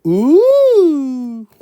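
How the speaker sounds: high cooing ooh from the crowd; audience ooh. Retold or imitated. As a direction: coo like a charmed audience. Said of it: "They go".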